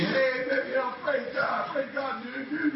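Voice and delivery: high, loud, goofy surfer praise; goofy happy surfer. Retold or imitated. Barefoot surfer praising God out loud, high goofy whoop. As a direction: whoop it up like a grinning beach kid. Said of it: "happy, goofy as can be".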